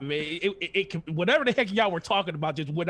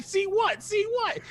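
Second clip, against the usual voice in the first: much higher, puzzled questioning; confused surprise; slower than usual. Retold or imitated. King baffled while everyone points at the screen, high confused questioning. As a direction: ask it high and baffled, looking around.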